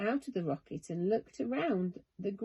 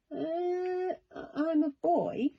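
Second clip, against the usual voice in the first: put-on high, hesitant child voice; hesitant boy; 9 semitones higher than usual. A narrator's reading. Charlie telling the creature what he is, hesitant boy voice. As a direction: stammer it out like a shy boy.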